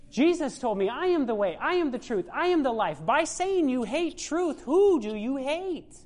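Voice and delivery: the preacher quotes Jesus to the church, a fervent preaching climax; preaching at full force. Acted. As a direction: drive the verses home to the congregation.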